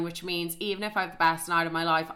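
normal talking voice

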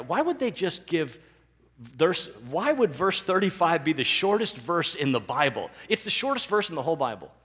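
ordinary talk, nothing marked

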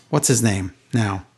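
ordinary talk, nothing marked

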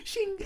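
high single shing of a blade; sword ring sound effect. Pretend.

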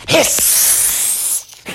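long voiceless cobra hiss; snake hiss. Acted. Cobra confronting bear under its rock, hissing. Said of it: "the cobra said".

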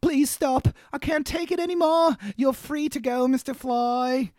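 put-on high, failed Australian accent; a weak stab at an Australian accent; hammed-up blubbering in a poor Australian accent; bad Australian accent. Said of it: "That was a really bad Australian".

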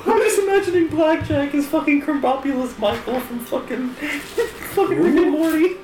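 high-pitched laughing chatter; cracking up while talking. Real, not acted. Laughing riff on a character.

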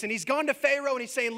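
ordinary talk

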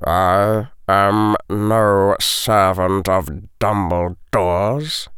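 slow, icy potions master; cold. A narrator's reading. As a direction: draw out each word with cold restraint. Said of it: "the potion master said coldly".

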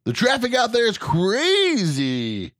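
high, loud, drawn-out DJ shout; hammed-up traffic report, stretching the words way up high; radio guy voice. Co-host mocks a traffic report, radio guy voice. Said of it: "That was radio guy".

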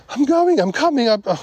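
mock-panicked yelp, out of breath; straining to keep up. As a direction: force the words out as if exhausted from walking. Lagging behind, told to hurry.

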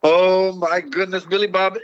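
ordinary talk

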